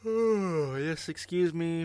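talking through a big yawn. Real, not acted. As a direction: yawn out the words. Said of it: "Excuse me, listeners, for that yawn".

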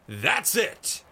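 high, loud shout of triumph; roar. A narrator's reading. Blazefeather seizing on East's answer in the pub, loud roar. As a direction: bellow it as the answer hits. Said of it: "roared Blazefeather".

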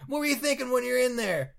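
high-pitched mock post-fight interviewer; Joe Rogan. Interviewing a fighter after a fight, eager high voice. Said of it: "or after a fight".

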